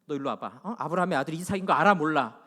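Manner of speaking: put-on parent quizzing a child sternly; lightly acted stern interrogation; mock scolding. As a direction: playfully imitate a parent's telling-off. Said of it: "그렇게 혼내세요?".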